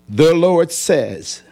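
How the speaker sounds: slow, weighty reading of the prophecy; solemn scripture reading. Acted. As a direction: intone the verse slowly and gravely. Preacher reads God's word slowly.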